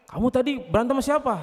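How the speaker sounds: high-pitched mother's interrogation; questioning mother. Acted. Mother asking who the child fought, high and probing. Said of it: "ke ibunya".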